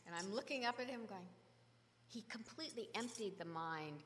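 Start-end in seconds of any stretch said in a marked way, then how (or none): none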